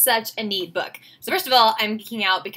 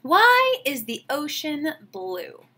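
put-on slow, gentle read-aloud for kids; storybook reading; slower than usual. A narrator's reading. Teacher reading a picture book to her class, slow gentle narration. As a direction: savor each line like a bedtime story.

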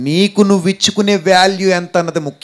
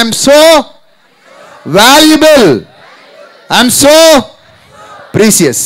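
high and louder affirmation, pushed harder; 8 semitones higher, 5 dB louder, much slower than usual. Shown as a demonstration. Preacher demanding louder from the congregation, loud call. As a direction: belt it out so the crowd joins in. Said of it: "చెప్పండి గట్టిగా".

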